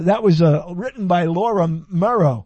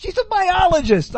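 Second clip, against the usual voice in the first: emphatic; 11 semitones higher, 6 dB louder than usual. Real, not acted. Excited emphatic correction.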